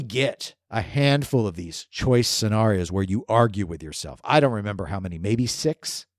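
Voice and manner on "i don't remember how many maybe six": usual speaking voice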